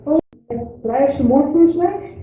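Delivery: put-on high, pleading kid voice; little daughter's voice. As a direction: imitate a small child sweetly asking Daddy for a treat. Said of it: "She said".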